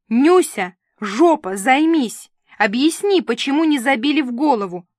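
loud, high scolding shouts; angry yelling. A narrator's reading. Director shouts at assistant Нюся through the speaker, furious. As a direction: bawl orders at a useless assistant.

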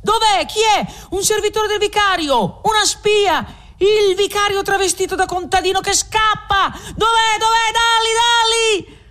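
high, shrill overlapping calls of a mob; many voices hunting the spy at once; clamouring crowd voices. A narrator's reading. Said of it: "Cento voci si spargono". (folla che grida, voce acutissima)

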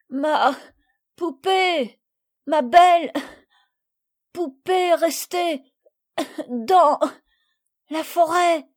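high, loud wailing broken by long pauses; sobbing child. A narrator's reading. Little girl crying over her doll lost in the forest. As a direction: wail it out between sobs. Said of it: "recommença à sangloter de plus belle".

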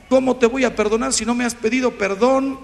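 ordinary talk, nothing marked

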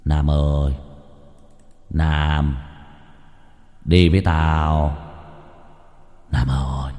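low, slow ghostly call, fading; a ghost luring him away in a dream. Acted. Ghost calls Nam in his dream, low and slow. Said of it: "rồi bảo".

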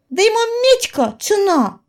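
put-on high indignant girl's cry. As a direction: protest the price hotly. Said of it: "возмущалась девка".

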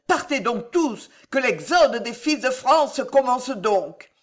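high, loud dramatic lament; impassioned angry outcry; growling anger. A narrator's reading. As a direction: declaim it with bitter, rising force. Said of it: "gronda Catherine".

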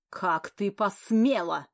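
loud, slow hiss through teeth. Acted. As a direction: hold back a shout between teeth. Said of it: "прошипела сквозь зубы графиня".

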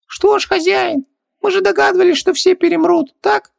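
very high squeaky little genie voice; squeaky genie servant, resigned; philosophical genie. A narrator's reading. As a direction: play a tiny high-pitched genie shrugging it off. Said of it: "философски развёл руками Хубаксис".

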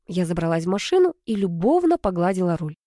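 ordinary delivery

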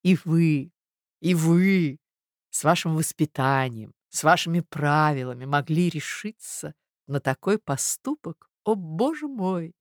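halting, shocked, restrained reproach; pained reproachful lady. Acted. Marya laments his eavesdropping, pained reproach.

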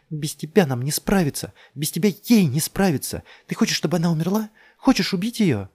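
high, loud accusing questions; desperate confrontation. A narrator's reading. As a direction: hurl it at him urgently. (отчаянно, громко давит)

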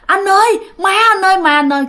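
high-pitched mock panicked calling out; acted cry for help, not a full shout; imitated alarmed cry. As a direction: call out to your brother in retold alarm, pitched high. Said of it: "mới la lên".

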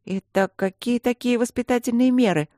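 high-voiced, strict demand; stern questioning. A narrator's reading. Student questioning the guardians' threat, high and stern. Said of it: "строго поинтересовалась Алинка".

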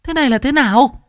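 high panicked shout. A narrator's reading. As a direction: shriek it in panic. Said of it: "gào lên bằng tiếng Trung".

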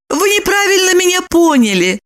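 very high, apologetic voice; babbling excuse. A narrator's reading. Lipochka, ashamed, backs down to Roman, babbling. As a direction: prattle an excuse, embarrassed. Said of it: "залепетала она".

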